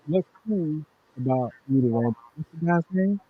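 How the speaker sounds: muffled, turned away from mic; talking off to the side of the mic. Real, not acted. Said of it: "you sound muffled".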